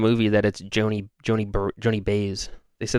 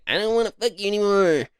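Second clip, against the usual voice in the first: higher, drawled nasal [name] voice; [name] impression; 8 semitones higher than usual. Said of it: "That is what he sounds like".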